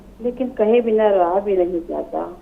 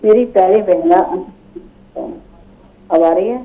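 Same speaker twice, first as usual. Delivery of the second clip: louder, projecting to be heard; louder on request; 6 dB louder, faster than usual. Real, not acted. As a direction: raise the voice for a bad line. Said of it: "जरा बुलंद आवाज से".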